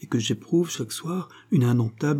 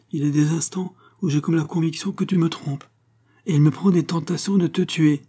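higher-pitched, heated confession; sudden outburst; 7 semitones higher than usual. A narrator's reading. Fatima threatening Don José with her dagger, sudden outburst. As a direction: let jealous anger rise, understated. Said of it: "lui dit-elle avec un emportement subit".

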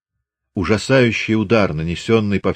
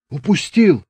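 lightly voiced Chubaty, high again; Chubaty's voice; 10 semitones higher than usual. A narrator's reading. Chubaty repeating to the sergeant, lightly voiced.

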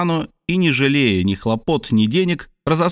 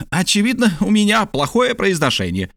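higher, lively scholar voice; a flustered geographer admitting his Spanish failed him, pitched up; voiced higher as the eager scholar Paganel; Paganel's voice; 9 semitones higher than usual. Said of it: "сказал он".

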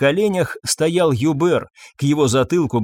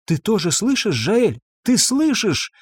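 urgent, higher-pitched questions; anxious question; 7 semitones higher than usual. A narrator's reading. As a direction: ask urgently, pitch lifting. Boy's bedside, shot heard.

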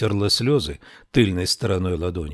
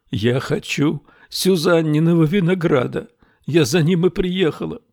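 high, louder tearful plea; 8 semitones higher, 3 dB louder than usual. A narrator's reading. Girl tearfully pleading with gendarme about Susanna. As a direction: plead through tears like a child.

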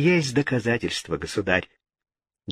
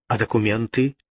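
ordinary delivery

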